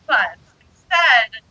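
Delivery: usual speaking voice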